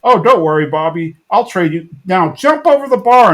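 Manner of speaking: loud, high, bossy coach impression; Dale. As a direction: imitate an overeager trainer, pushing the volume. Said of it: "And so Dale's like".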